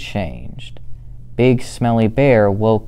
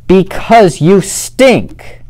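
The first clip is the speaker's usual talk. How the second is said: loud, much higher shout; 10 semitones higher, 8 dB louder, slower than usual. Acted. Fluffy Bear shouting down Smelly Bear, loud and high. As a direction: top the other bear's yell. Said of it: "shouted Big Fluffy Bear".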